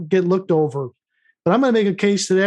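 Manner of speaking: ordinary talk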